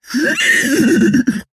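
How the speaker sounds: little high-pitched laugh; high giggle. Acted. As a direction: giggle up high like a tiny creature. Starfish character laughs happily.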